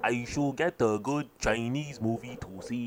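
slow it down in a fake Chinese accent; mock Chinese accent. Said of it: "Okay, yeah, do Chinese".

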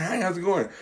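quiet, soft imitation of a hello; polite coworker greeting. Coworker greeting Susan and Kathy, softly mimicked politeness. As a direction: mimic a mild office greeting. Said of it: "it's like".

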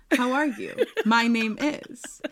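giggly mock formal intro, slowed down; laughing greeting. Shown as a demonstration. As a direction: act out the proper hello with a laugh. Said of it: "Whatever happened to hello?".

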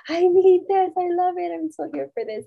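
high, loud and thrilled; gushing excitement. Retold or imitated. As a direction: gush like you love it.

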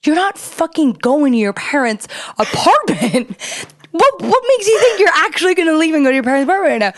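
mock-outraged, climbing to a shriek; furious disbelief. Wife refusing to let John leave, rising into high outrage. Yelling at husband in fight.

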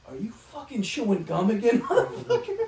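high, loud telling-off breaking into laughter; mock angry scolding. Boss catching employee chewing gum, mock angry yell. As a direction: imitate a boss exploding in disbelief.

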